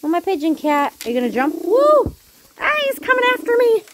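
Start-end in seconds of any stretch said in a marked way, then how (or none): 1.6-3.8 very high, loud squeal, then fast; excited squeal. Real, not acted. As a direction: squeal as the cat leaps. Cat jumps at her.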